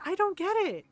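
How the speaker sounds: high-pitched exasperated confusion; retold bafflement. Telling Ron she can't understand it, baffled. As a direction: lift the pitch sharply, playfully baffled. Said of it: "I was really confused by this".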